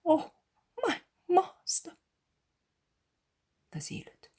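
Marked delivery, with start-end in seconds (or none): 0.0-2.0 high, breaking cry of grief; John crying out to his master, near tears; tearful cry. Acted. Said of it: "when he whispers".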